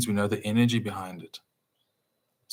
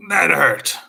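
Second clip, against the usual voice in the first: high, loud mock yelp of pain; 7 semitones higher, 8 dB louder than usual. Speaker acting out stubbing a toe, loud pained yelp. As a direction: yelp like you just stubbed your toe. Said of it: "We go".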